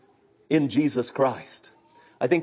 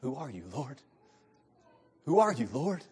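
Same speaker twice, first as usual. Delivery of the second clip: awed question; 8 dB quieter than usual. Acted. Paul on the ground answering.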